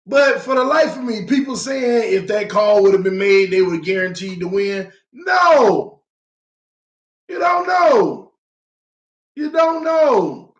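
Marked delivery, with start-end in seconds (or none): 5.1-10.5 higher, slow insistent shout; slow raised insistence; heated shout. Real, not acted. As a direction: drive home you don't know. Heated rebuttal.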